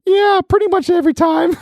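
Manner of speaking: put-on high-pitched little kid voice; eight-year-old boy. Little brother answers over the headset, high child voice. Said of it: "Seth's eight-year-old brother".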